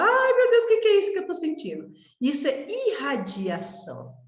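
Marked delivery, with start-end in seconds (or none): 0.0-1.9 high, loud, rushed alarmed new medium; startled medium asking what she feels; alarmed cry. Retold or imitated. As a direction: blurt it out in a panic. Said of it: "Porque você fala".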